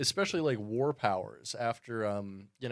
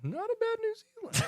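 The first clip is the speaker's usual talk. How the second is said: teasing. Real, not acted. Teasing repeat.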